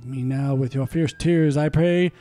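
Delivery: ordinary talk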